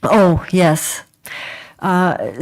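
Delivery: usual speaking voice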